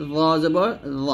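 জ জবর জ with a thick, heavy জ; darkened heavy জ; heavy zoa. Shown as a demonstration. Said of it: "জটা মোটা হবে".

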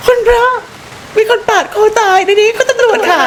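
real fright, half-joking call for police; shocked scream. Real, not acted. Streamer discovering a throat-cut corpse, high shocked scream.

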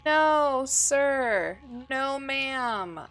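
real panicked shouting; panicked yelling. Real, not acted. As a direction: yell as if the thing is right there. Panicking at horror game.

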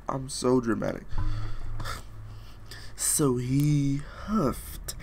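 ordinary talk, nothing marked

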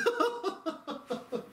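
sudden high giggle; real amused laugh; high burst of laughter. Real, not acted. Laughs at the absurd reply.

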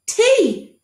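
high, loud eager cry; exclaimed. Acted. Boy remembering a letter, excited cry. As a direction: blurt it out in triumph. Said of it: "exclaimed the boy".

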